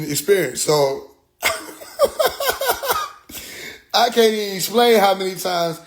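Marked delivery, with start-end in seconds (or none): 1.4-3.9 high-pitched burst of laughter. Real, not acted. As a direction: crack up. Laughs at own story.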